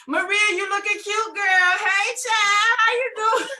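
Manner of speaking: high, loud, gushing hello; gushing greeting. Retold or imitated. [name] acting out her cheery messages to friends, gushing. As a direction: gush over a friend.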